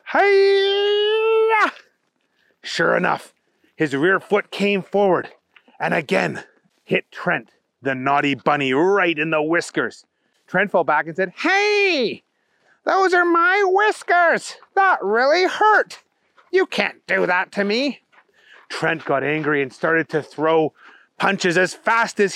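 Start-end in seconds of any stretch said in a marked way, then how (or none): 0.0-1.7 long, very high karate yell; yelled battle cry. A narrator's reading. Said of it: "he yelled". 11.4-18.0 high, slow, indignant bunny whine; Trent, hurt protest. Acted.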